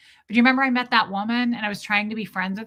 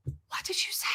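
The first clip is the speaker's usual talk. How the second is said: menacing whispered question; hushed menace; 10 dB quieter than usual. Retold or imitated. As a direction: hiss it in disbelief. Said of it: "he goes".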